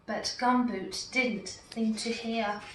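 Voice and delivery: plain reading voice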